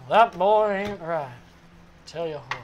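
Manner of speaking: cartoon dad impression.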